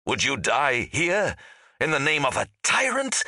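high, angry rhetorical challenge; hollow, harsh. A narrator's reading. Angry ghost challenging soldiers in battle, hollow and harsh. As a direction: hurl the question in a hollow, harsh voice. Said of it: "hollow and harsh".

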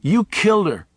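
put-on high, petulant child's accusation. A narrator's reading. Boy Miles accusing Charlie, high and petulant. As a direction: fling it at him like a sulky boy. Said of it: "even more petulant".

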